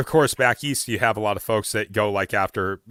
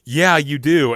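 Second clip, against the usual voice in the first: louder, higher teasing comeback; teasing retort; 6 semitones higher, 7 dB louder, slower than usual. Retold or imitated. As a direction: snap back playfully. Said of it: "I was like".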